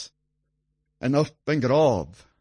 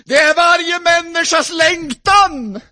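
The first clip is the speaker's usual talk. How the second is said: higher, louder shout of hope; preaching shout; 6 dB louder than usual. Acted. Preacher shouting to the congregation about hope. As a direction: cry out the hope.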